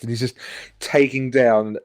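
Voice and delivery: ordinary talk, nothing marked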